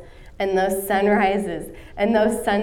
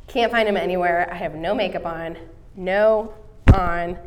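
ordinary talk

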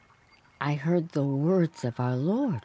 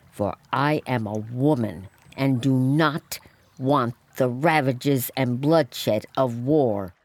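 usual speaking voice

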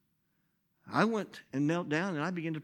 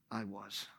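much softer and lower; hushed confession; 7 semitones lower, 11 dB quieter than usual. Real, not acted. Pastor revealing he was the prisoner, hushed. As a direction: drop to a hush. Sermon climax.

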